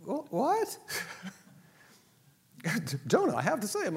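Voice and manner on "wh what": high startled stammer; surprised little yelp; mock shock. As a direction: jolt up in disbelief.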